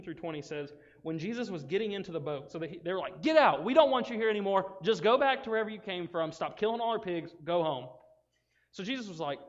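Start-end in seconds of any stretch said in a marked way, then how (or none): 3.2-7.9 loud, pitched-up telling-off; acted angry crowd. Angry townspeople ordering Jesus to leave, acted anger. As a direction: drive him away with loud indignation.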